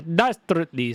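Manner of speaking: "dastardly" in a hammed-up Transylvanian accent; cartoony Transylvanian accent. Shown as a demonstration. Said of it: "sounds better in that voice".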